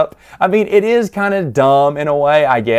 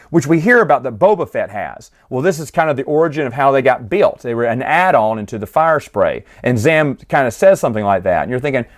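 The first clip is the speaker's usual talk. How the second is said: usual speaking voice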